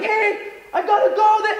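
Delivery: very high, loud mock excuse; awkward hasty exit. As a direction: squeak out a panicked goodbye. Fleeing in embarrassment.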